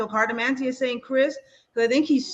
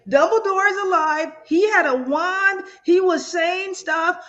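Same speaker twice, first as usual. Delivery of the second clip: higher and slow, breathless with wonder; excited; 6 semitones higher, 3 dB louder than usual. Retold or imitated. Telling her wife she saw Dumbledore, excited and higher. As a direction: tell them, wide-eyed, that Dumbledore lives. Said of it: "I was like".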